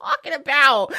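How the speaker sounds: high, loud baffled protest; mock outrage. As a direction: act outraged and confused. Mock outrage at Bible contradictions.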